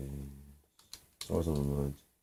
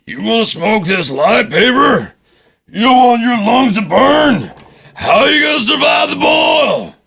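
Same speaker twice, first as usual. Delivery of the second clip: high, slow, roaring drill sergeant bark; drill sergeant yell; 9 dB louder than usual. Acted. Drill sergeant screaming at a recruit, roaring. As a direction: bellow it like a boot camp sergeant.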